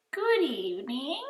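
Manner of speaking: lilting high greeting from a polished monster; high silky voice. Acted. Monster Ralph greeting the boy in a high silky voice. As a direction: greet him sweetly and high. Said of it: "in a high, silky voice".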